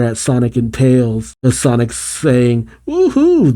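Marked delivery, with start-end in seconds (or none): 2.9-3.5 high, cheering whoop; gleeful whoop. Acted. Sonic cheering as the ship explodes, gleeful whoop.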